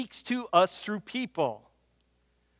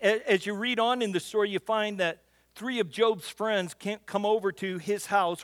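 normal talking voice

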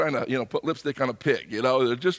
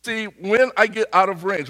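ordinary talk, nothing marked

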